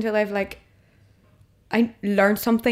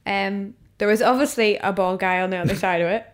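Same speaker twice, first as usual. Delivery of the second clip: normal talking voice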